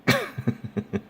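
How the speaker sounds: high wheezy laugh; real high-pitched giggling fit. Real, not acted. Reader cracking up at his own punchline, laughing.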